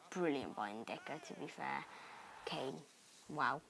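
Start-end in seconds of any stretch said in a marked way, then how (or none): none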